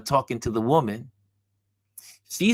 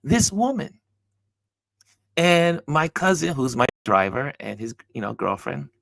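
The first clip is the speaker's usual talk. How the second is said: normal talking voice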